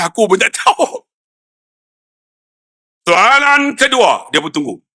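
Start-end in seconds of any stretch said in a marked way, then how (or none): 3.1-4.3 slow, higher drawn-out announcement; Quran contest examiner. Retold or imitated. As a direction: call out the next contest question, stretched. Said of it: "saya buat macam musabaqah". (gaya juri musabaqah, meleret)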